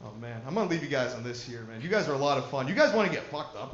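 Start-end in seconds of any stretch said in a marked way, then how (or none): none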